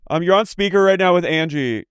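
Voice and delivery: high, chirpy speakerphone voice; phone speaker. Retold or imitated. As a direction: talk to a speakerphone. Role-playing a speakerphone call.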